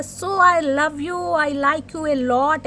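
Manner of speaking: very slow, drawn-out mock lover; slow lovestruck confession. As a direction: croon it like a dreamy admirer. Voicing the lover from cards. (बहुत धीमा, खिंचा हुआ प्रेमी वाला इज़हार)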